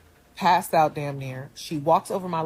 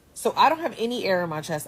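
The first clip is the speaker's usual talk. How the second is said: usual speaking voice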